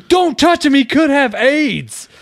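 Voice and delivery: high, loud panicked warning, slowing at the end; acting out a shrieked warning; imitated teacher's scream. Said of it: "that teacher screamed it at me".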